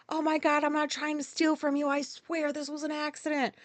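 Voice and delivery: high, frantic protest of innocence; mock panicked plea. Retold panicked plea to store staff.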